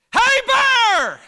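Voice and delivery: sudden loud high shout that makes listeners jump; sudden yell. Retold or imitated. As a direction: bark it out at the approaching animal, high and loud. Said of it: "I yelled it out".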